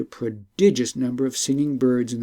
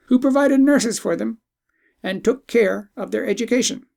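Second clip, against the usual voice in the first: talking bird, revealing; 10 semitones higher, 4 dB louder than usual. Acted. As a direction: lay out the secret with weight.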